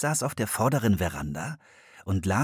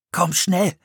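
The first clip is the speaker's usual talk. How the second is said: louder, hurried push; urging; 9 semitones higher, 6 dB louder than usual. A narrator's reading. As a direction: hurry your sister along. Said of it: "drängte Philipp". (drängend und laut)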